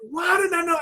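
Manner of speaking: high-pitched, exasperated question; retold frustration. Student asking his coach why he missed it, frustrated. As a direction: demand an answer, exasperated. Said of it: "And I'm frustrated".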